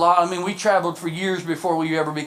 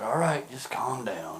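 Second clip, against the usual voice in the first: low, soft mock reassurance; hushed, deep "settle down" voice; hushing; 7 semitones lower, 9 dB quieter, slower than usual. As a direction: imitate a preacher quietly shushing someone down. Preacher hushing the excited lady.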